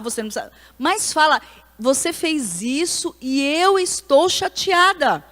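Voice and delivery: high, slow, emphatic urging; insistent urging. Retold or imitated. Preacher urging her pastora friend to speak up, high and slow. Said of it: "eu falava".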